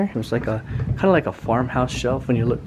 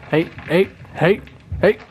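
higher, louder calls to a dog; calling out; 6 semitones higher, 6 dB louder than usual. Real, not acted. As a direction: call the dog sharply.

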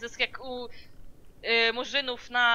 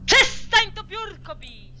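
scolding shout; 9 semitones higher, 8 dB louder, slower than usual. Real, not acted. Scolding him for hitting desk.

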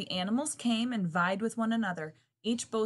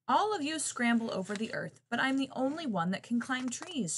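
raised pitch, far short of shrieking; mild mock shriek; 6 semitones higher, 3 dB louder than usual. A narrator's reading. Monkey bragging to the other animals, mildly shrieked. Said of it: "shrieked the monkey".

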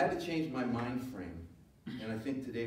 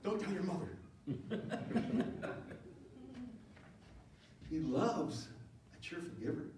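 ordinary talk

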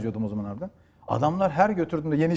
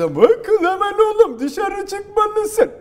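loud, slow dubbed-movie dad shouting; bağıran baba; 7 dB louder, much slower than usual. Acted. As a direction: scold the son in a hammed-up dad voice. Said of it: "Baba bağırıyor işte". (bağıran dublaj babası sesi)

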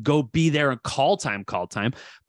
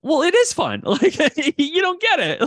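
laughing; 13 semitones higher, 3 dB louder than usual. Real, not acted. Laughing defense of long hours.

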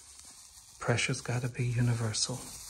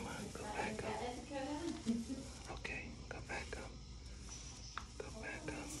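hushed whisper while working; soft, whispered instructions; 13 semitones higher, 13 dB quieter than usual. Real, not acted. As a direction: talk yourself through the job in a whisper. Whispers along while painting.

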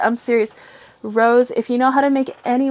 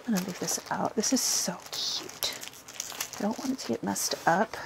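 very soft, low murmur; hushed admiration; 3 semitones lower, 13 dB quieter than usual. Real, not acted. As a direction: say it under your breath, half to yourself. Murmurs while admiring the cloth.

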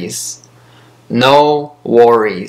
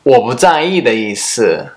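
ordinary talk, nothing marked

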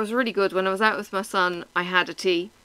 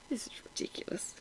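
a hushed, breathy disbelief under the breath; whispered aside; 3 semitones higher, 16 dB quieter than usual. Retold or imitated. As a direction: whisper it to yourself, incredulous. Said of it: "It was just like".

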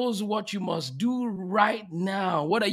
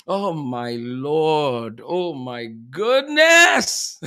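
slow groan swelling into a loud cry; overwhelmed awe; 5 semitones lower, 5 dB louder, much slower than usual. Real, not acted. Host overcome after guest's talk, overwhelmed exclamation. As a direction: start low and drawn out, then swell.

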